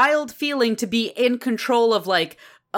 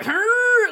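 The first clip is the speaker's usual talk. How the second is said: strained grunt; 13 semitones higher, 4 dB louder than usual. Retold or imitated. Dust devil straining.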